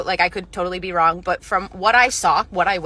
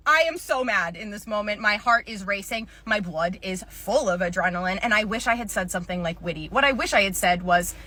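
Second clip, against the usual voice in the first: normal talking voice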